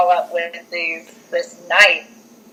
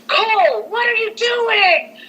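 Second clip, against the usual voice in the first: high, drawn-out screech of an upset mother; mock scream; slower than usual. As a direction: imitate a mom shrieking at her son, without real volume. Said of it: "she's, like, kinda screaming at him".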